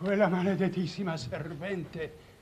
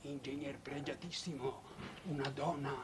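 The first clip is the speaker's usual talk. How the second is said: hushed, much softer warning; fearful confiding; 8 semitones lower, 13 dB quieter than usual. Acted. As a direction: drop the voice as if she might hear. Fearful confiding about the marchesa.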